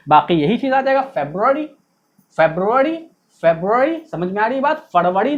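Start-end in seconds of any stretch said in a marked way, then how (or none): 1.1-4.0 फरवरी said "FEB-ru-ari", ending raised; English pronunciation. Shown as a demonstration. Teacher drilling students, English "FEB-ru-ari". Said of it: "English में फेब होता है". 4.9-5.3 फरवरी as "FUR-va-ri"; Hindi pronunciation. Shown as a demonstration. Teacher rejecting it for English, Hindi "fur-va-ri". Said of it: "नहीं".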